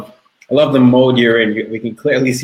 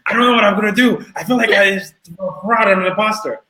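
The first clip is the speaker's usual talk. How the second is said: much higher, breathless mock client gushing; excited panic; 10 semitones higher than usual. Client tells Tyler about first sale, anxious excitement. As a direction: play the thrilled client tipping into self-doubt. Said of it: "And someone said".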